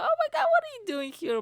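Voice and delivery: imitated shriek of astonishment, very high and loud; squealed mock disbelief; shocked surprise. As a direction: mimic a mother stunned by a surprise visit. Acts out mother's shock at visit.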